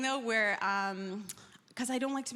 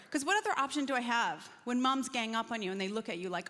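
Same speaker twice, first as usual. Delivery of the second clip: usual speaking voice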